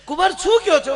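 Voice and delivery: high, worried mother-in-law. Acted. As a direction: pitch up high and fuss. Mother-in-law answers the crying son-in-law.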